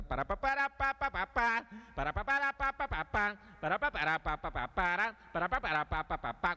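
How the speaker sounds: imitating band. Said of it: "Estaba la banda tocando así".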